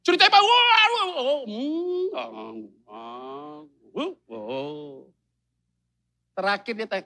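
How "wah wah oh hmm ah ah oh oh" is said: husband pretending to listen to his wife's long story; mock listening noises. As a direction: fake rapt attention, fading out.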